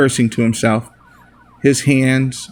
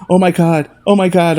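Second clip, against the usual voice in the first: shocked panic; 6 semitones higher than usual. Acted. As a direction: panic rising as he's shot. Shooting just happened.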